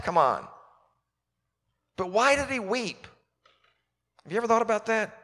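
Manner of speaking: ordinary delivery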